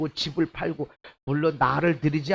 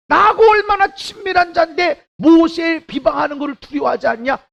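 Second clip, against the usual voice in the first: preaching at full force; 12 semitones higher, 8 dB louder than usual. Acted. Pastor voices God rebuking Moses' critics, shouting. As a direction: thunder it out as God.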